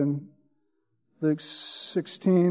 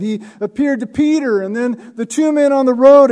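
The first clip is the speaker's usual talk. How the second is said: higher and louder preacher's voice; energetic preaching; 6 semitones higher, 7 dB louder than usual. Acted. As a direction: drive the story home with fervour.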